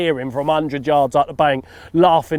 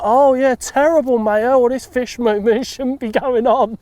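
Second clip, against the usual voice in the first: mock sympathy; 9 semitones higher than usual. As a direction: overplay the shock to hide your guilt. Fake sympathy to bailiff.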